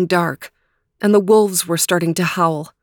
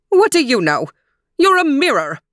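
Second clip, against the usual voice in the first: loud, high furious roar; roaring queen; 7 semitones higher, 3 dB louder than usual. Acted. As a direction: roar it down at the mirror. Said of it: "roared the queen".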